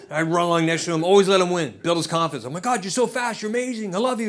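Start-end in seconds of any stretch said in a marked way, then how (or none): 2.6-4.3 put-on higher, doting dad cheering his toddler; gushing praise. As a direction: pour it on like a proud dad.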